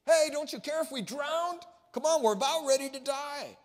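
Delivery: loud, high, desperate cry for help; panicked plea. Acted. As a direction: shout it like you're about to drown. Disciples waking Jesus in panic.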